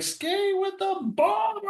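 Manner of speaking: put-on high-pitched, slow drunken cry; drunk yelling. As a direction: mimic a giddy drunk friend. Drunk friend quoted laughing.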